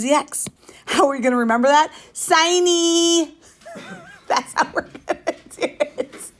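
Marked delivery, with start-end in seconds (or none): 2.1-3.3 high, drawn-out whine on siny; whiny voice. Shown as a demonstration. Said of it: "you gotta do it in the whiny voice". 3.8-6.3 laughing. Real, not acted. Laughs at own silly mnemonic.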